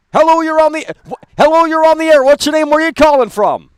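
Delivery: high, loud repeated greeting; shouting. Real, not acted. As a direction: yell it so they finally hear. Repeats greeting much louder.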